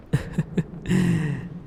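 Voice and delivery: a light amused giggle; teasing laugh. Acted. Demon lord laughing as the hero jumps, amused giggle. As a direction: laugh as he flinches.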